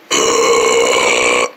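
low, rough throaty burp sound; fake burp. Boys burping after gulping drinks, deep burp. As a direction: force a long deep burp. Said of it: "We started burping".

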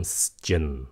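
"shin" said as hissed s plus chin; "s-chin" sound. Shown as a demonstration. Teacher introduces third ending sound, "shin" as s-chin. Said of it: "âm xì".

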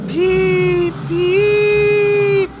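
high piercing whistle shrieks; shrieking. A narrator's reading. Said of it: "he shrieked".

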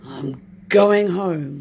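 slow, weary sigh. Acted. Cold tired Bear heading home, sighing slowly. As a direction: let it out tired and beaten. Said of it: "he sighed".

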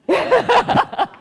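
bright high burst of laughter. Real, not acted. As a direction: laugh out loud. Laughing with audience.

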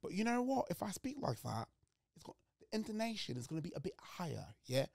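English accent from England. Shown as a demonstration. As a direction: switch into a British English voice. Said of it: "de l'Angleterre".

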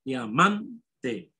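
diamante with MAN held, then "te" set apart; stress on MAN. Shown as a demonstration. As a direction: lean hard on the "man" in diamante. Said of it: "la sílaba tónica la segunda sílaba".

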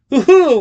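high, loud hoot; crowing cry. A narrator's reading. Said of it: "Bleacher cried".

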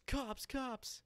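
high mock panic call of cops; mock alarm. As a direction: yell it out like a fake warning. Kid warns of police, fake alarm.

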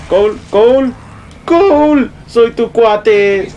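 high-pitched drawn-out call. Real, not acted. Gamer calling to Cole as his buddy, calling out. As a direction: call a teammate, stretching the words.

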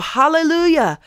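high, ringing cry of praise. Acted. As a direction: lift the voice high and proclaim it. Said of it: "Once more they cried out".

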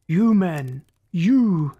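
raging chief barking orders at his crew; thunderous order. A narrator's reading. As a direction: call it out as a thundering command. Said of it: "volleyed orders in a thunderous voice".

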